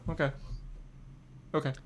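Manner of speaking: normal talking voice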